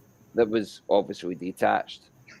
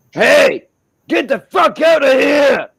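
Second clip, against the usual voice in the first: very loud, high-pitched yell; acted squatter yelling intruders away; furious shout; 14 semitones higher, 12 dB louder, slower than usual. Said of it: "this guy is still shouting".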